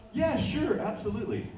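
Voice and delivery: mock eager agreement. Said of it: "And I was like".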